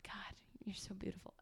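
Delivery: unvoiced, breathy-free whisper aside; mock adoring whisper. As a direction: whisper it just to him. Aside to partner.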